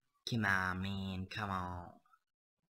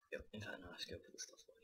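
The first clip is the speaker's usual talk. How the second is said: whispering; 3 semitones lower, 13 dB quieter, faster than usual. Real, not acted. Muttering while sorting chests.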